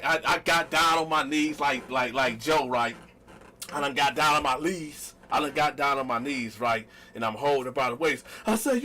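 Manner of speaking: usual speaking voice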